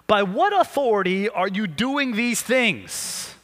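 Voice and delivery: slow, deliberate demand from the priests; challenging elders. Acted. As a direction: press the question slowly. Reading the elders' challenge aloud.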